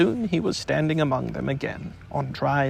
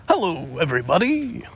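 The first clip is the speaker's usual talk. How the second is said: cheerfully; 4 semitones higher than usual. A narrator's reading. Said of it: "said Tigger cheerfully".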